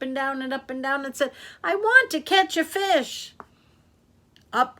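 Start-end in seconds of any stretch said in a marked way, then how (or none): 1.6-3.3 put-on high, loud childlike demand; impatient child. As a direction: whine it out, impatient for a catch. Impatient girl demands a fish.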